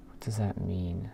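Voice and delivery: ordinary delivery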